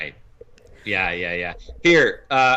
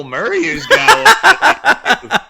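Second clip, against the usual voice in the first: laughing; 11 dB louder, much slower than usual. Real, not acted. Laughing at the gossip.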